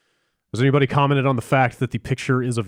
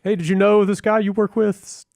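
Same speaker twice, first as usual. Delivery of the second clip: excited relative voice; 9 semitones higher than usual. Retold or imitated. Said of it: "Just kinda like".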